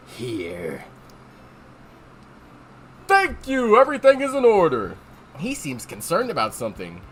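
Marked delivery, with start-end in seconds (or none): 0.1-0.8 very low, quiet, brooding voice; Kirk Thornton. Acted. Aloof passenger handing over a ticket, low and brooding. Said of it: "do my Kirk Thornton". 3.1-5.0 high, loud, hearty thanks; bright official approval of a ticket; cheerful conductor. Acted. Conductor accepting a ticket, cheerful.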